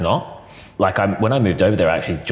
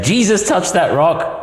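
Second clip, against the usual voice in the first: 1 American evangelical; 10 semitones higher, slower than usual. Retold or imitated. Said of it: "loud American evangelical Christians".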